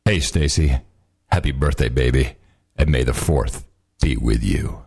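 low, smooth radio announcer; sultry broadcast voice. Pretend. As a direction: purr it like a late night DJ. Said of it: "in his sultry v-, broadcast voice".

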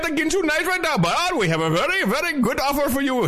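put-on high, fast Pakistani accent salesman; hammed-up Pakistani accent pitch. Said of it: "Can't you do it with the Pakistani accent".

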